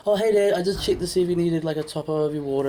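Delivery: high, fast innocent cover-up voice; caught-out daughter. Retold or imitated. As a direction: rush it like a kid caught out. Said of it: "she was like".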